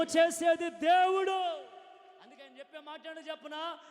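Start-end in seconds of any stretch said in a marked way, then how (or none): none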